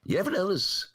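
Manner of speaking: a light stab at a Carlin bit; George Carlin imitation. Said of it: "that's my George Carlin imitation".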